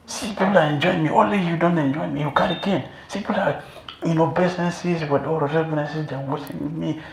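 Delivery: high-pitched, hammed-up tearful complaint; tearful Krishna. Krishna complains to his mother about the cane, mock crying. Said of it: "And Krishna will cry".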